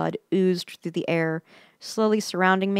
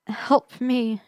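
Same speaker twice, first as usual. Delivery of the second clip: higher, plaintive plea for help; plaintive cry; 6 semitones higher than usual. A narrator's reading. Limping woman calls from behind, plaintive. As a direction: plead plaintively, pitched up. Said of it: "she cried plaintively".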